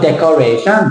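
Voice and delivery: Thai-accented "decoration", rising final SHUN. Shown as a demonstration. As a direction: say "decoration" the Thai way, dec-kor-ray-SHUN. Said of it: "Thai people".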